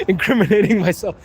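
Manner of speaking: tickled, livelier echo after a laugh; real amusement just after laughing; amused repeat. Real, not acted. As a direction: repeat it as a joke on yourself.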